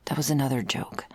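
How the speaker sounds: normal talking voice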